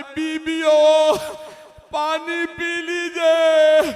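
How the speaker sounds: high, loud, very drawn-out call; slow lamenting appeal; wailing plea. Acted. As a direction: cry out to the bereaved women, stretching the endings. Acted plea to bereaved women.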